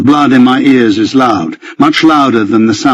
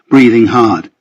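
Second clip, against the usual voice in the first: ordinary delivery, nothing marked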